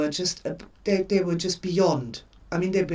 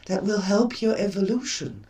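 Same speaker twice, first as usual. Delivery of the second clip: ordinary talk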